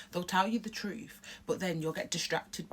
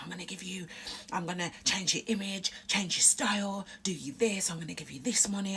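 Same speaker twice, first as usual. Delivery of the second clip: sly whisper; 3 semitones higher than usual. Retold or imitated. Record man whispering promises to a teen. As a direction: sell it in a secret whisper. Label man's pitch, retold.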